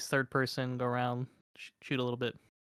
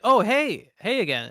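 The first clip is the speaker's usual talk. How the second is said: cheerful greeting; 9 semitones higher, 6 dB louder than usual. Retold or imitated. Acted friendly greeting by NPC.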